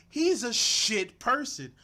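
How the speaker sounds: loud, slow, stunned outburst; shocked outburst. Real, not acted. Reacting to the clip, a loud stunned slow outburst. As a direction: blurt it out, floored by what he heard.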